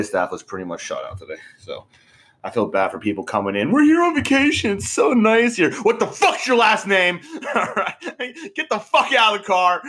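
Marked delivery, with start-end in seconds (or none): 3.7-5.7 very high, sing-song tourist voice; mock tourist. Mocking tourist guests. 5.8-9.8 high, laughing rude valet voice; mock rude valet.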